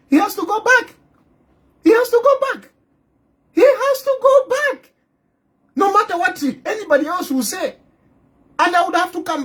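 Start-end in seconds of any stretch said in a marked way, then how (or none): 0.1-0.9 mock official insisting. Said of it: "The government here will say". 1.8-4.9 high mock official. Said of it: "The government here will say".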